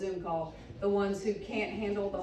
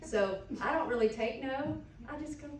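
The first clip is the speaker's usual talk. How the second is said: ordinary talk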